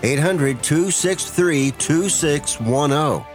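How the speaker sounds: usual speaking voice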